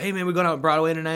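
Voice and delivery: quick, loud retold invitation; eager buddy. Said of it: "my buddy's like".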